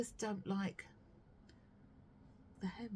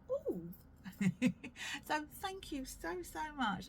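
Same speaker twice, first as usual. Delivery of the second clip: 1 impressed ooh; 7 semitones higher than usual. Retold or imitated. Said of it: "So he was like".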